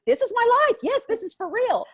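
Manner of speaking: this is my life, thrown up high; mock despair at the chaos; exasperated. Exasperated reply to daughter.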